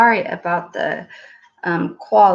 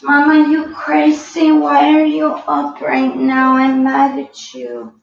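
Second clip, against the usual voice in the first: higher, louder mock baby scolding; voicing the baby; 5 semitones higher, 5 dB louder than usual. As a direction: speak for the baby, playfully indignant. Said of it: "you're like".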